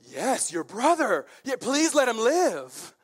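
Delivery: high, loud, drawn-out agreement; hammed-up grateful agreement; eager pleading. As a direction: seize the chance and plead eagerly. Captives eagerly seize on Ahab's word.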